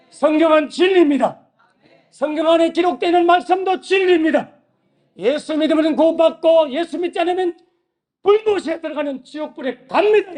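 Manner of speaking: preacher declares heaven and hell to the congregation, ringing loud; full-force preaching. Real, not acted. 회중에게 크게 외치는 설교.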